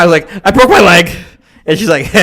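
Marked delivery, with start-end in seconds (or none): 0.5-1.1 loud, high urgent cry; panicked yell. Retold or imitated. Telling his girlfriend he broke his leg, loud panicked yell. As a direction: shout it up the stairs.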